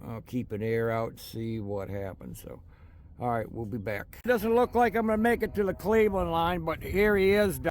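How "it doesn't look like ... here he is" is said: speaking up over the rumble of a train; raised voice over train noise. Real, not acted. Talking over a passing train.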